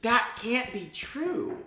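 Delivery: high, slow, drawn-out incredulity; acted disbelief. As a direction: play up being stunned, stretching the words. Acts out own disbelief at correction.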